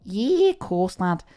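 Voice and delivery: high-pitched Scouse imitation; Scouse accent. Imitating a TikTok lad, Scouse accent. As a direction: put on a Scouse lad's voice. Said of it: "Scouse TikToks where it's like".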